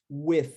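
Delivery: "with" ending in a voiceless th, tongue between teeth. Shown as a demonstration. Teacher demonstrating the unvoiced TH in "with". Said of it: "língua entre os dentes, não vozeado".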